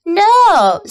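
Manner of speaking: high, startled piglet; a piglet blurting out no; young pig voice. A narrator's reading. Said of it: "said Wilbur".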